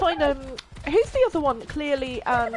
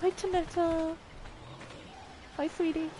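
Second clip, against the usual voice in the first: high, soft cooing hello; tender greeting; 4 semitones higher, 10 dB quieter than usual. Real, not acted. Greeting sweetie Tanetta, soft and tender. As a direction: coo gently to a little pet.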